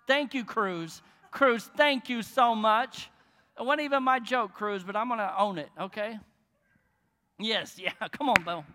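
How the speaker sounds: loud, delighted calling out to the crowd; excited shout-out. Real, not acted. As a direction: holler thanks across the room, slowed on the thanks. Calling out thanks to a laughing listener.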